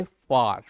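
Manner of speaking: फार with a drawn-out aa; long vowel. Shown as a demonstration. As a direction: stretch the aa in फार. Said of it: "थोड़ा लंबा जाता है".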